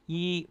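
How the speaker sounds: YI held high and level; high, steady YI; first tone. Shown as a demonstration. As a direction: sustain YI on a high even pitch. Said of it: "first tone".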